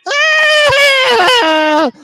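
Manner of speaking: very high, loud witch scream; imitating the neighbor's witchy shriek. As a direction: screech like a cackling witch. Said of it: "like a cackling witch".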